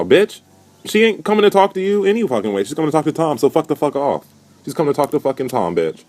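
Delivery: high-pitched, incredulous clapback; indignant telling-off. Acted. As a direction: tell him off, voice pushed way up. Telling off Lowton in his absence.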